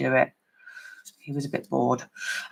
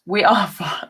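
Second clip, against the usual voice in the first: laughing; 3 semitones higher, 5 dB louder than usual. Real, not acted. Laughs while saying it.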